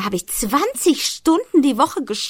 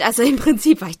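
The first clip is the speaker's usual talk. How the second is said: ordinary delivery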